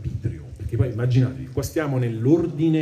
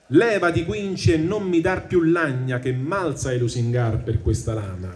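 poem recitation; 5 semitones higher than usual. Acted. Teacher reading the Inferno to the class, measured recitation. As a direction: recite the tercets with weight.

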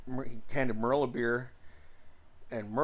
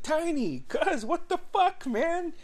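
much higher, delighted shout of recognition; surprised greeting. Retold or imitated. Brother spotting cousin Tiny at party, higher surprised delighted call. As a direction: yell his nickname like you can't believe he's here.

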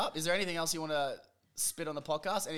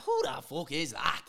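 put-on loud, drawn-out Dublin Irish accent; brash put-on Irish accent; Conor McGregor; 3 semitones higher, 6 dB louder, slower than usual. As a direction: bellow it slowly in a Dublin brogue. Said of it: "I just did a Conor McGregor accent".

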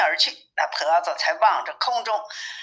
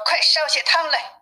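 mildly angry order, pitched up; 7 semitones higher than usual. A narrator's reading. Wukong orders the old woman to heat soup, angry. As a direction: snap it a little higher, impatient for action. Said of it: "悟空发了火".

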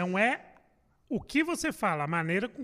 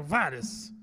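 ordinary talk, nothing marked

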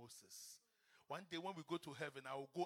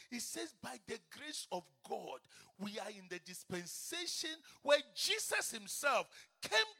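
building louder and louder preaching; full-force preaching; 10 semitones higher, 20 dB louder than usual. Acted. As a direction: climb to a roaring altar call.

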